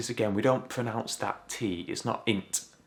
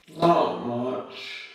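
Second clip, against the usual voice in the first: louder, higher, strongly accented "Not much"; broad Yorkshire; 5 semitones higher, 6 dB louder than usual. Shown as a demonstration. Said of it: "let's see an example".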